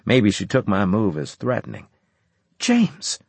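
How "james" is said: sharp warning. Acted.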